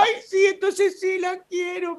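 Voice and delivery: high, slow, tearful mother voice; imitating mom. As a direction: drag it out high like a mother moved to tears. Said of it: "Y me dice mi mamá". (imitando a la mamá llorosa, aguda y lenta)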